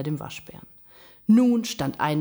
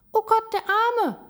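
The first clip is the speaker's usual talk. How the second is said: very high squeaky squirrel voice; squirrel's dismay; 4 dB louder, much faster than usual. A narrator's reading. Squirrel sees the cornered raccoon dog, dismayed squeak. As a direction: exclaim in high-pitched pity.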